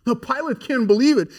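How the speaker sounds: usual speaking voice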